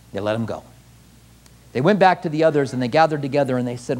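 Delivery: usual speaking voice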